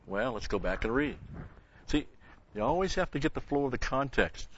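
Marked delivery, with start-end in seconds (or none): none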